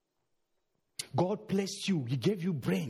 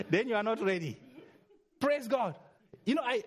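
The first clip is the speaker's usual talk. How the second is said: laughing; 4 semitones higher than usual. Real, not acted.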